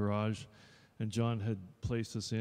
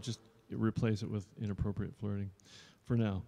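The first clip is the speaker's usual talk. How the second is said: usual speaking voice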